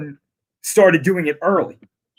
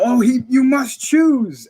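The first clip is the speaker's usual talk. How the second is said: high, slow, drawn-out plea; imitating Palpatine; 8 semitones higher, slower than usual. Palpatine pleads with Anakin to choose, imitated high slow plea. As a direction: act out a villain pleading, stretched high. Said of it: "you hear Palpatine go".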